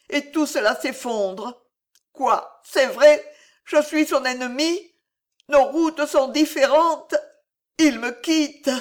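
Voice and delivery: high, pained outburst of an abandoned father; acted despair, pitched high and pressed; anguished disbelief. A narrator's reading. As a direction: raise the voice in hurt, stunned disbelief. Abandoned father despairs, about to weep.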